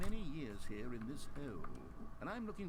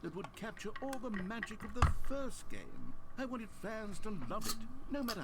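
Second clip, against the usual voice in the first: passionate outburst; 7 semitones higher, 12 dB louder than usual. Acted. Narrator passionately laments the sequel.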